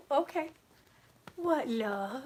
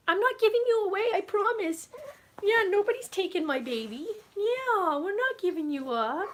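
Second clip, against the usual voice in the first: puppy talk; 9 semitones higher than usual. Real, not acted. Owner soothing her anxious puppy, high cooing baby talk. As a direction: reassure the little dog in a sing-song coo.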